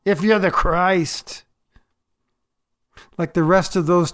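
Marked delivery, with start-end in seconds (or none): 0.0-1.4 a mildly acted taunt, drawn out at first; hurled insult. Crucified criminal railing against Jesus, mildly insulting. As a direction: throw it as an insult, with some restraint. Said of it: "throwing insults in his direction".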